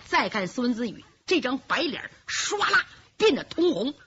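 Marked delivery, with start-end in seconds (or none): none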